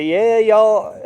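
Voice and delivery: loud, high Southern barge-captain voice calling on the radio; Southern hillbilly drawl. Retold or imitated. Bargeman answering a sailor over the radio, Southern accent. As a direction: play a friendly Southern river bargeman, Southern accent. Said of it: "they'd say".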